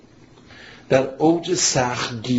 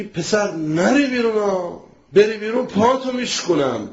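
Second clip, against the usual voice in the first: harsh threat; 6 semitones higher than usual. Shown as a demonstration. Preacher showing a father threatening his son, mock harsh. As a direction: lay down the law like a bullying father. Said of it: "اینجا بهش میگی".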